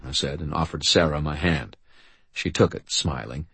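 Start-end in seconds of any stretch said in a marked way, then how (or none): none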